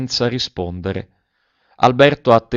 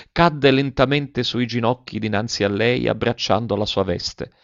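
plain reading voice

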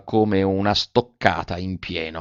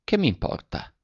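soft, higher, hard to tell if stung or indifferent; unreadable tone; 7 semitones higher, 7 dB quieter than usual. A narrator's reading. Velleda answering Alberto's refusal to marry, soft and unreadable. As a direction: toss it off so no one can tell if it hurts. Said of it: "non si sarebbe potuto indovinare se fosse alterato o indifferente".

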